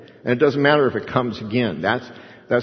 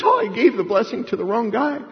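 high-pitched, dismayed realization; shaken Isaac; 10 semitones higher than usual. Acted. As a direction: act out a father aghast at his mistake. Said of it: "and then he's shaking".

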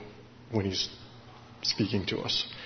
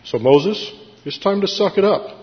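firm God voice; 8 semitones higher than usual. Retold or imitated. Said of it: "jolting back to reality".

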